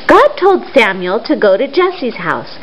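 usual speaking voice

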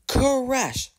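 high, drawn-out exclamation; dramatic crash. A narrator's reading. Narrator voicing Thomas hitting the buffers, dramatic. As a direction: burst out like a sudden collision.

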